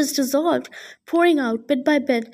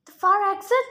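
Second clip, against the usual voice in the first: urgent cry; 6 semitones higher than usual. A narrator's reading. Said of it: "Rachel cried".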